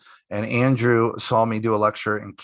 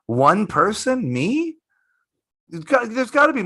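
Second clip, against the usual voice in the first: incredulous; 11 semitones higher than usual. Retold or imitated. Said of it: "incredulous retelling".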